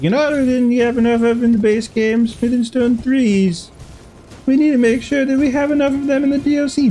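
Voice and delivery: high, lively imitation of game developers; mock developer voice. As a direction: play the devs plotting, high and bright. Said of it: "everyone get together and be like".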